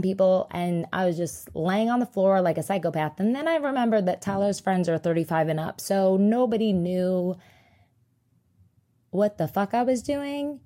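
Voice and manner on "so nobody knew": normal talking voice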